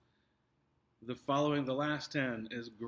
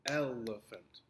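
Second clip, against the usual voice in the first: "elephant" said EL-e-phant, drawn out slowly; stress on first syllable; slower than usual. Shown as a demonstration. Teacher gives the right option, "elephant" stressed EL-e-phant. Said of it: "The first syllable is emphasized".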